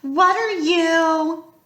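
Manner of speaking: high, drawn-out call. A narrator's reading. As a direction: call after someone, stretching the words. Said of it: "Annabeth called".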